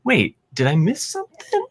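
high-pitched mock confusion; baffled double-take, voiced high; confused viewer. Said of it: "and it's like".

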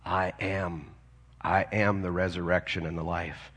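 lightly acted solemn declaration, low and soft; Jesus. Answering the grieving sister at the funeral, quietly solemn. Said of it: "Jesus turns to her and he says".

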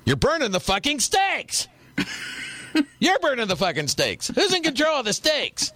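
high hammed-up yell about the steaks, laughing; mock yelling parent. Parent at the grill yelling about burnt steaks, high and mock-frantic. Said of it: "it was like".

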